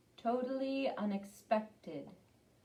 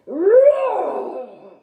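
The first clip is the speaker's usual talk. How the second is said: huge, loud, high lion roar; 11 semitones higher, 18 dB louder than usual. Acted. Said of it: "He lets out a big roar".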